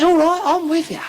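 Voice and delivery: put-on high, loud old lady agreeing; old woman voice. Old woman at the dance eagerly agreeing, high and loud. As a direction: chirp it up like an eager old dear.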